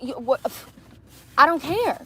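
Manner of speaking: ordinary delivery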